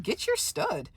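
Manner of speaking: high, soft, pushy mock voice; pointed bystander look. Onlookers telling the teller to handle her stud, mock pushy. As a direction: hand her over to me with a look. They all looking at me like.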